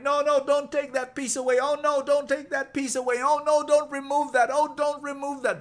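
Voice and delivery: high, loud desperate pleading; pleading scream. Acted. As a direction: beg him to stop, crying out. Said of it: "I wanted to scream inside".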